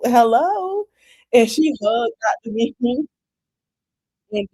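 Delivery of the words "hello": sing-song hello. Retold or imitated. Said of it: "Doctor Mimi say".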